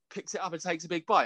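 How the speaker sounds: usual speaking voice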